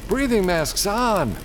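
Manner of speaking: high, slow command; captain shouting orders before the breach; shouted order. A narrator's reading. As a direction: call the order across the car. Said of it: "shouted Stanley".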